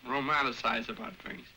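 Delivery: usual speaking voice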